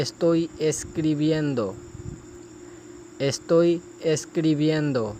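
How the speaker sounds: slow, careful Spanish, each syllable clear; slow model phrase. Shown as a demonstration. Teacher modelling a Spanish phrase for students, slow and careful. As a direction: model it slowly for learners. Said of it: "اگلا فقرہ ہے".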